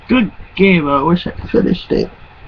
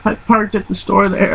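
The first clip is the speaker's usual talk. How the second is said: ordinary delivery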